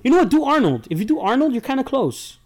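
high-pitched egging on; excited teasing. Real, not acted. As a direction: push him, playful and loud. Teasing friend to do Arnold.

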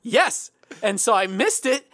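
ordinary talk

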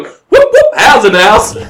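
very high, loud whoops in mock Irish; hammed-up, high Irish hype call; Irish announcer catchphrase. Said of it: "but in an Irish accent".

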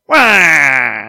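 loud nasal wah cry; Wario wah. Shown as a demonstration. Correcting the voice with a loud Wario wah. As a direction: bark out a cartoon wah. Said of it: "it's more of a".

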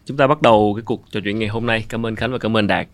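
ordinary talk, nothing marked

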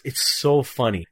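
ordinary talk

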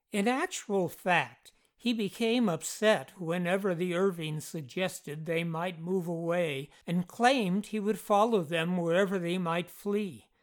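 ordinary delivery

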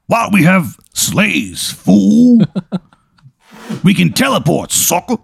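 mock the question like a cocky bruiser; Mister T voice. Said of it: "Looks just like Mister T".

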